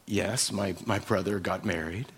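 an awkward man barely getting it out; lightly voiced bashful suitor; shy quiet reply. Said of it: "he's like".

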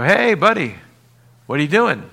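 shouting to a worker in a hole; calling out. Pretend. Calling down to digger.

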